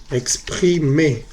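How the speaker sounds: very slow, syllable-by-syllable "Exprimer"; slow modelling. Shown as a demonstration. Teacher modelling a French verb for students, slow and syllabic. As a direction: draw out each syllable of "Exprimer".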